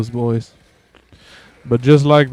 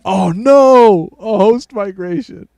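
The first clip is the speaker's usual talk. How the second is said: alarmed; 7 semitones higher, 3 dB louder, slower than usual. Real, not acted. Host migration panic.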